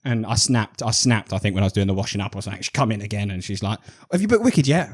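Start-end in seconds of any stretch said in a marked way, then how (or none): 4.1-4.9 higher, lightly voiced pestering question; a hint of an impatient girlfriend; mock nagging partner. Partner asking again while he washes up, mock nagging.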